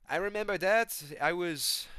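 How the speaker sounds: high, loud attempted impression; GSP. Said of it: "G S P's like".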